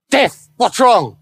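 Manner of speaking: high, slow, impatient shout; devil's shout. Acted. The devil yells at a terrified Death, demanding answers. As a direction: bark the question at the trembling imp.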